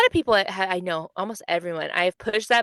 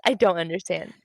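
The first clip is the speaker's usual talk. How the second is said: normal talking voice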